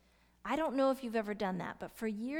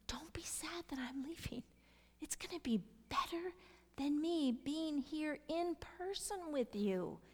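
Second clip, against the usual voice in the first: hushed, higher voice, tenderly consoling; gentle reassurance; 5 semitones higher, 3 dB quieter than usual. Acted. Jesus telling disciples not to grieve his leaving, tender hush. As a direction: comfort them softly, almost whispering at first.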